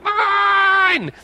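high shouted claim of ownership; childish shout. Retold or imitated. Little kid shouting at classmate over a watch. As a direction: yell it like a grabby first grader.

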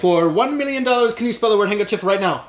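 loud, high, fast quiz-host challenge; game-show challenge. Acted. Host daring his co-host to spell a word, loud game-show voice.

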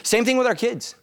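normal talking voice

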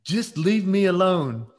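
man yelling at partner. Retold or imitated. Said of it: "I hear this voice that says".

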